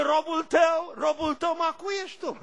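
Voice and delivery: high, loud mock shout of "Robul tău"; mocking servant's cry. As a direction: parody the grovelling plea "Robul tău". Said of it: "Să-l fi auzit cum zice".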